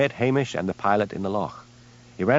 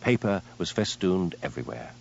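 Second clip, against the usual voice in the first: ordinary delivery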